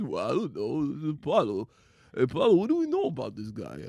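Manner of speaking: mumbling boxer interview impression; slurred, punch-drunk movie boxer voice; Rocky Balboa. Fan imitates Rocky talking in interviews, mumbled impression. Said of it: "see him talk and do interviews".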